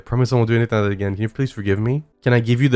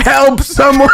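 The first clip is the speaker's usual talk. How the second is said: screaming for help; 12 semitones higher, 8 dB louder, slower than usual. Real, not acted.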